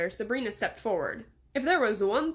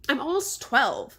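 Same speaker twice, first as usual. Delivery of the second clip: higher, louder indignant protest; shouted; 4 semitones higher, 6 dB louder, slower than usual. A narrator's reading. Girl insulted about her age, shouting back. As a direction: protest it loudly, drawing out the words. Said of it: "Sabrina shouted".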